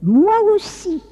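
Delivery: put-on second child. Second child agreeing after waking, childlike. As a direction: pipe up like a small child.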